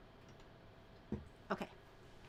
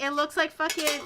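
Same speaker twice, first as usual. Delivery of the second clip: ordinary talk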